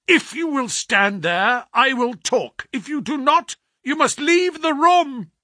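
furious ultimatum. A narrator's reading. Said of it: "a look of furious anger".

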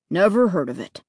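plain reading voice

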